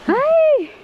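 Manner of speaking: shout. Real, not acted. Shouting across on the climb.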